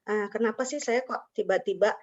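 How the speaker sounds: ordinary delivery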